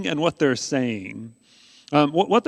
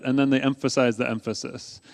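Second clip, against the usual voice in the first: usual speaking voice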